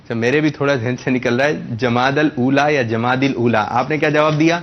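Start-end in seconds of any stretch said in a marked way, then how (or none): 1.7-3.7 "جماد الاولا" as jamaadal-oola, then as jamaadil-oola; two pronunciations contrasted. Shown as a demonstration. Host asking which month name is right, two pronunciations contrasted. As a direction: offer "جماد الاولا" with -dal versus -dil. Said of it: "اس کا درست تلفظ ہے".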